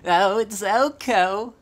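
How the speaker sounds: high, loud, drawn-out mock accent; put-on exclamation. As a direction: call it out slowly in a hammed-up high voice. Replays the [name] voice as a gag.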